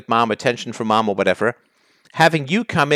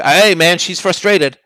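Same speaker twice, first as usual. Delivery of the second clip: mock-casual shrug, higher and louder; mocking the excuse; 4 semitones higher, 5 dB louder, slower than usual. Host mocking the caller's stock excuse, mock-casual. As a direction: parody his easy excuse, hammed up a little. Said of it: "every single time it's anything that's close to a criticism of you".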